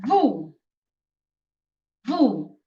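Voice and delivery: "vu" said as "voo"; "vu" rhyming with "too"; Italian letter name. Shown as a demonstration. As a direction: say "Vu" with a pure oo, each one set apart. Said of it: "in Italian is".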